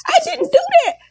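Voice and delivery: high-pitched imitation of a tearful wail; mock hollering and crying. Friend on the phone protests to Heavenly, hollering and crying in imitation. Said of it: "She started hollering and crying".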